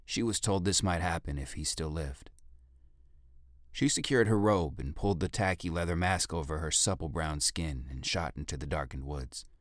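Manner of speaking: ordinary delivery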